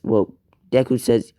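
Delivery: usual speaking voice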